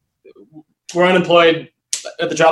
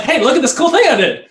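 high-pitched, eager show-and-tell; excitedly reenacted boast; really excited; 10 semitones higher than usual. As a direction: retell it like proudly showing off a new creation. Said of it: "really excited".

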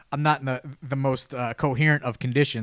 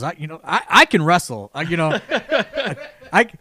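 louder, higher mock protest breaking into a laugh; riled up; 4 semitones higher, 6 dB louder than usual. Retired wrestler insisting he can still wrestle, louder, higher, half-laughing. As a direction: imitate a buddy getting worked up, half-laughing. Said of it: "getting himself real, real riled up".

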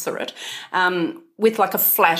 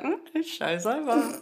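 soft, slow, higher-pitched aside; private inner remark; 6 semitones higher, 8 dB quieter, slower than usual. Retold or imitated. Spotting the neighbour in the shops, soft private aside. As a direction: say it quietly as if only to yourself. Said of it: "in my head I go".

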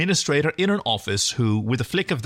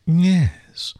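breathy whisper; 5 semitones higher, 5 dB louder than usual. Acted. Breathy seductive alien.

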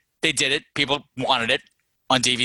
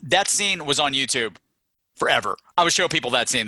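ordinary talk

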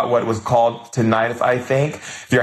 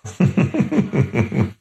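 delighted laugh; 4 semitones higher than usual. Real, not acted. Delighted laugh after thanks.

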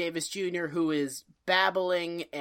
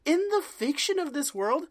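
normal talking voice

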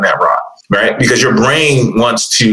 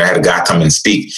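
usual speaking voice

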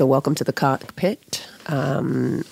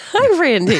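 high-pitched greeting; 11 semitones higher, 5 dB louder than usual. Acted.

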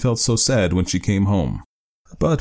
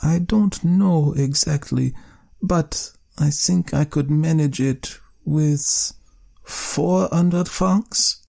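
slow, higher and drawn-out hesitation; wife naming her price, slow and hesitant; hesitating; 8 semitones higher, much slower than usual. Acted. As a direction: weigh each word before the sum.

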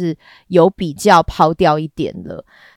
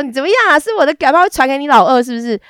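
生气质问；高声、带火气的半开玩笑质问；假装发火地顶回去；对大壮假装生气地质问; 7 semitones higher, 4 dB louder, faster than usual. Retold or imitated. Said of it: "所以我就生气说".

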